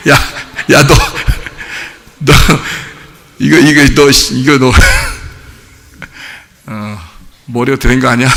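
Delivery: halting, lost-for-words mock scolding; dumbfounded reaction. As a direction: stammer it out in low fragments with long pauses. Acted dumbfounded reply to repeated apologies.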